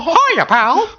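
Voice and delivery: very high, loud squeaky cartoon mouse; Mickey Mouse voice. Imitating Mickey Mouse for coworkers. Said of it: "Mickey Mouse".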